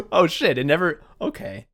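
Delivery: ordinary talk, nothing marked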